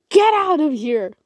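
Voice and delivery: loud, high furious roar. A narrator's reading. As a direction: roar him out of the room. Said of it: "he roared".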